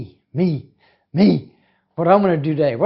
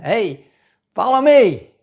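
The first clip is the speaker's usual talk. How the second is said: calling out; 5 semitones higher, 7 dB louder, slower than usual. A narrator's reading. Jesus calling disciples.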